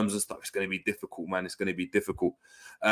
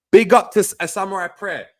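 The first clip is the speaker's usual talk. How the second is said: excited shout-out; 9 semitones higher, 8 dB louder than usual. Real, not acted. Excited shout-out to Japanese viewer.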